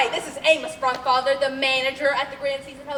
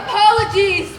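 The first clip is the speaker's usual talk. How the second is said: laughing shout; 6 semitones higher, 9 dB louder than usual. Real, not acted. Announcer flustered by the interruption.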